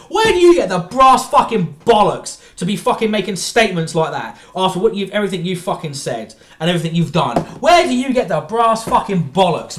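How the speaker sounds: loud, heated ranting; raging tirade at a pop star; angry rant. Real, not acted. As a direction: tear into him, furious. Furious rant at Brian.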